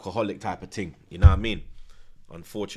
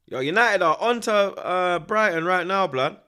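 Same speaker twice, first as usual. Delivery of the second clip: excited; 9 semitones higher, 7 dB louder than usual. Real, not acted. Excited match update.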